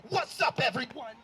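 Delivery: loud, high-pitched mock greeting; imitating hyped-up bridesmaid. Imitating bridesmaid opening her wedding speech, over-excited. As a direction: holler it way up high to the room. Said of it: "this is how she starts".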